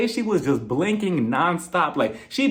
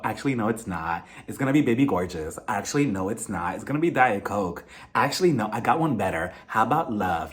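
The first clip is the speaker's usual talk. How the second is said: Lisa's low drawling voice; 10 semitones lower, 4 dB quieter than usual. Retold or imitated. Host mocking Lisa's pick with a lower voice. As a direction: dip low and keep changing your mind. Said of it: "she starts it off and she was like".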